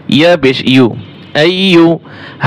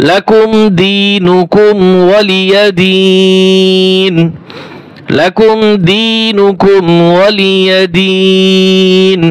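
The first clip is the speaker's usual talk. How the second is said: slow, melodious tajweed chant; Quran recitation; 4 semitones higher, much slower than usual. Shown as a demonstration. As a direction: recite the verse in long drawn tones. Recites the final verse twice. (ধীর সুরেলা তিলাওয়াত)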